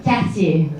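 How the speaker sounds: Cartier in a French accent, kar-TYAY; French pronunciation. Shown as a demonstration. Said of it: "Can you say it in French?".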